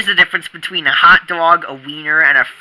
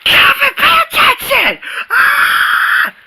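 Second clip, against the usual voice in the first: teacher yelling; slower than usual. Acted. Teacher yells at daydreaming Calvin to pay attention. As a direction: snap him out of the daydream.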